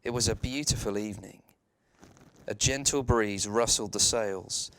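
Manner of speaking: ordinary delivery, nothing marked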